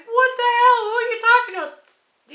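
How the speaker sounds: high, loud alarmed husband; husband jolted awake by shouting; startled husband. Retold or imitated. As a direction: bark it like a man woken abruptly. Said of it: "He's like".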